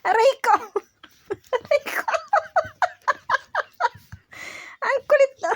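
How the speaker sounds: high squealing ouch through laughter; laughing squeal. Real, not acted. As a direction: squeal and laugh at the playful pup. Laughing as the dog plays rough. (tumitiling tawa)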